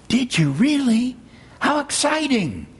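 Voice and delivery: high-pitched, eager exclamation; excited listener. Acted. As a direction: react with delighted interest, pitch lifted right up. Eager reply to Eeyore's news.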